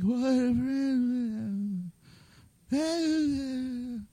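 very slow, soft, bored mumble; listless mumble. Retold or imitated. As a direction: drag the words out half-asleep in the pew. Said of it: "we come to church and it's like".

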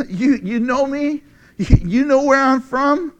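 high, mocking rhetorical question; sarcastic question. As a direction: throw it back as a sarcastic question. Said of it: "a term of sarcasm".

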